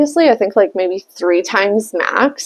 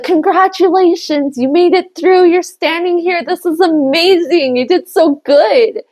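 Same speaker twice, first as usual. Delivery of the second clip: mock congratulating coworker; 7 semitones higher than usual. Coworker congratulating her on surviving. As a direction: overdo the congratulations.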